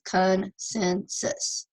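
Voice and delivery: "consensus" slowly in three parts; slow teacherly breakdown of "consensus"; syllable by syllable. Shown as a demonstration. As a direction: sound out "consensus", pausing between syllables. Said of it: "Three more vowels".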